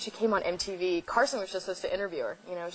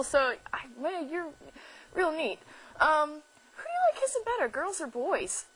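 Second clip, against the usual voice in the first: high, eager, rambling fan gush; nervous gushing; 7 semitones higher than usual. Retold or imitated. Young host gushes at her celebrity crush, high and eager. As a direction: gush nervously like a starstruck kid. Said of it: "I'm standing there and I'm like".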